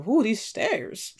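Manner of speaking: slow, breathless complaint about the stairs; winded old lady. Retold or imitated. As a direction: drag it out as if out of breath. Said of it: "She was like".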